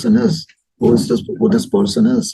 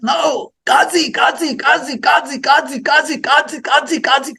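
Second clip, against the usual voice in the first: higher-pitched retold calling of a name; calling out repeatedly; 6 semitones higher than usual. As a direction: call the name over and over across a courtyard. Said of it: "Shouting out my name".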